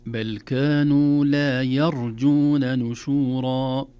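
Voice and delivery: loud, slow chanted recitation; recitazione coranica. Shown as a demonstration. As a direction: chant the verse with long, sustained vowels. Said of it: "ترقيق الياء".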